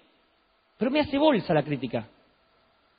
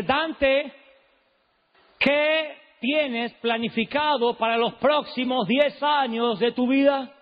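higher and slow, last note lifted a tone; Alberto Motesi impression; 5 semitones higher, much slower than usual. Preacher imitating Motesi questioning him, high and slow. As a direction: stretch it out slow, ending each phrase a tone up. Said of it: "tiene como el último tono".

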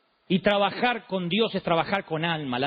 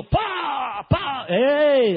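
higher, louder shout; sudden shout; 6 semitones higher, 4 dB louder, much slower than usual. Retold or imitated. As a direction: bark at the kid. Padre grita al hijo. (el padre le grita al nene, fuerte y agudo)

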